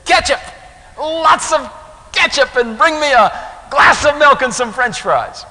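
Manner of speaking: put-on loud, high, eager little boy; great enthusiasm. Boy answering the waitress, loud with great enthusiasm. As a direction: burst out with the order like a thrilled kid. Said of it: "great enthusiasm".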